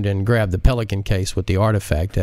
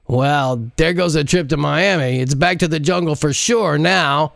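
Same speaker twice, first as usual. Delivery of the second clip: higher teasing male sidekick voice; Jacinto teasing; 7 semitones higher than usual. Acted. Jacinto mocks Modise for flirting, in a higher teasing voice. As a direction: rib her about the pilot, higher voice.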